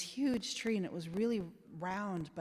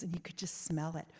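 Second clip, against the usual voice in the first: normal talking voice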